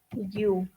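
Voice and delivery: "you" opening on a Y glide, said softly; consonant-like U. Shown as a demonstration. Teacher repeating the sound of U, soft Y onset. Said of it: "como si fuera una consonante".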